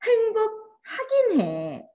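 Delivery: "행복하긴 해" pitched high and stretched slow, reluctant; grudging "happy enough". Shown as a demonstration. Teacher mimicking how people hedge: high slow grudging "행복하긴 해". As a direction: draw out "행복하긴 해" high, like a half-hearted admission. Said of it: "잘 느껴봐".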